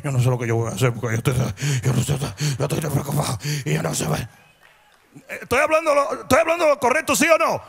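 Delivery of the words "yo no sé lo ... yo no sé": much lower and fast, grumbling worry; mock worried mumble. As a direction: mumble the complaint fast under your breath. Cristiano quejándose a escondidas. (queja preocupada imitada, grave y rápida)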